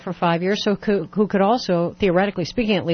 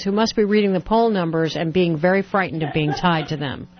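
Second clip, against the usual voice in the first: ordinary talk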